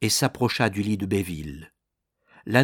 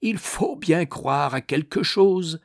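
slow, unhurried remark; measured, high-pitched composure; imperturbable phlegm; 7 semitones higher, 3 dB louder than usual. A narrator's reading. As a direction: drawl it slowly, wholly unbothered. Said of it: "avec un flegme imperturbable".

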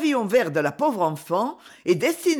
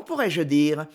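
ordinary delivery, nothing marked

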